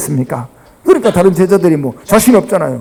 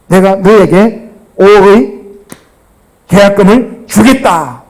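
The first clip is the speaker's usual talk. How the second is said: slow solemn promise; slower than usual. Shown as a demonstration. Example promise, slowed.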